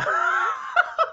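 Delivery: high, loud shrieking burst of laughter; loud laughing shriek. Real, not acted. Reader cracking up at the doctor's joke, shrieking laughter.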